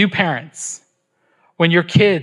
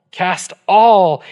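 all hit louder with a falling tune; stress on all; 5 dB louder than usual. Shown as a demonstration. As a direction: punch the word all. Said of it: "What does it say?".

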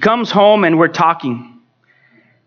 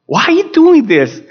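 higher, insistent plea to God; retold anguished cry; 6 semitones higher, 3 dB louder than usual. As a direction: cry the question out in reenacted protest. Father retells pleading with God.

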